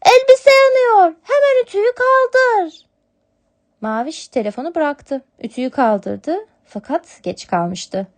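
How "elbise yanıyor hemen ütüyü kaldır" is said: much higher, louder urgent kitten warning. Acted. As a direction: kitten warning her sister the dress burns. Kitten warns of fire. (yavru kedi sesi, telaşlı)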